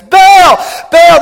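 high, loud frantic scream of the name; desperate screaming. Retold or imitated. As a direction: scream for the idol in panic. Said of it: "they start freaking out".

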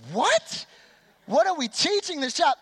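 stunned what, then rising outrage; shocked disbelief. Retold or imitated. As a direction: react like you can't believe your ears. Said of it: "I was like".

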